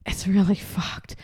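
slow hushed whisper; whispered aside. Real, not acted. Mum grumbling to her son about the rules, slow whisper. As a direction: drop to a conspiratorial whisper.

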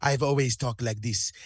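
low, mock Nigerian accent; imitated Nigerian accent. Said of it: "and he was like".

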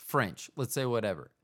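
usual speaking voice

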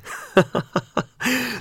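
high laugh. Real, not acted. Laughing at own joke.

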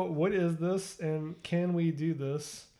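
usual speaking voice

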